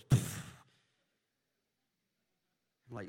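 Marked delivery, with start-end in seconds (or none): 0.0-0.4 loud burst of air; imitated door popping open. Other car door flies open, explosive puff. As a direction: puff it out as a sound effect. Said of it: "And the other door opens".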